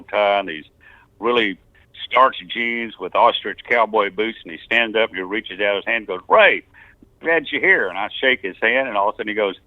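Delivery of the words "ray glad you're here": hammed-up friendly welcome; hearty greeting. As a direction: greet him like an old friend. Voicing the engineer's greeting.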